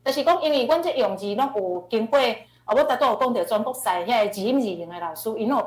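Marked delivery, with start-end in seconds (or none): none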